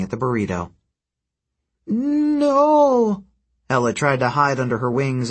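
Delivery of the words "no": high, drawn-out harpy wail of protest; stuttered cry. A narrator's reading. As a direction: stretch the start, then cry out very high. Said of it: "she stuttered".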